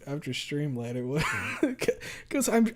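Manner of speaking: ordinary talk, nothing marked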